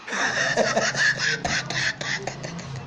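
long, soft, breathy cackle; mocking laugh. Caller doubts the begging will work, mocking laugh. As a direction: laugh it out until it fades. Laughs at helping him beg.

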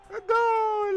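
long goal yell. Real, not acted. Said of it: "yelling "goal".

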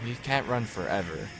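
usual speaking voice

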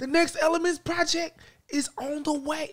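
pitched high, stress on "Next"; high odd emphasis on "Next". Shown as a demonstration. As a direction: hit "Next" way up. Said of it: "I'm trying to put emphasis".

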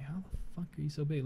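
very quiet mock-baffled aside; hushed amazement. Retelling how he asked his brother about his size, hushed. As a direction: drop to a near mutter in disbelief.

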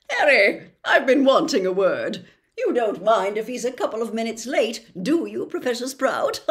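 a vain celebrity professor, loud and chummy; booming, self-satisfied charm; Lockhart voice. Acted.

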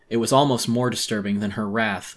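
plain reading voice

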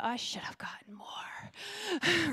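slow, breathy whispered regret; whispered thought. Retold or imitated. To the congregation, recalling her thought, slowly whispering. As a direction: let the wish slip out under your breath. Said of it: "and I thought".

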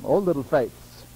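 low tender reproach; gentle rebuke. Retold or imitated. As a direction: say it low, like a loving teacher. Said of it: "that's what he called them".

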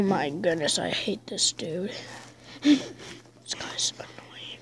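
soft annoyed whisper. Real, not acted. Player whispers about an annoying opponent. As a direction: hiss it under your breath.

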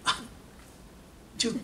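ordinary talk, nothing marked

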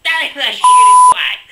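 very high, loud squealing yell. Acted. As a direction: shriek it in mock horror. Exaggerated reaction to tan.

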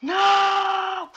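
high loud shouted no; anguished shout. Pretend. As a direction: wail it out in grief. Friend dies in game.

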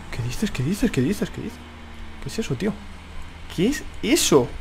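very soft, rapidly repeated questioning; hushed disbelief. Real, not acted. Gamer reacting to a baffling obstacle on the track, hushed disbelief. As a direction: say it under your breath, incredulous.

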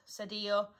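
ordinary delivery, nothing marked

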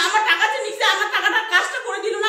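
loud, high-pitched real outrage; indignant demand. Real, not acted. Customer demanding the money back, indignant demand.

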